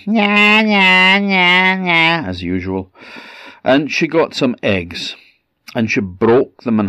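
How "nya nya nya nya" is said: put-on high, loud whining baby cry; mock baby wail. A narrator's reading. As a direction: imitate a baby's nagging wail, sinking at the end. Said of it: "lying in the cradle going".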